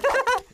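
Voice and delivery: quick high giggle; short laugh. Acted. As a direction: snort out a little laugh. Still laughing at prank.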